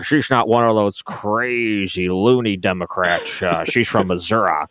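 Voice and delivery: slow, drawn-out southern drawl. Retold or imitated. Host imitating a rural Missouri campaign ad, slow southern drawl. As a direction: play a folksy southern guy from a campaign ad. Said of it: "with the big draw".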